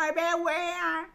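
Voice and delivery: coarse mock ch'ti accent, loud and high; mocking northern accent. Mocking the narrator by repeating her line in a thick northern French accent. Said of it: "répétait ma phrase en accentuant".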